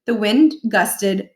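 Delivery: ordinary delivery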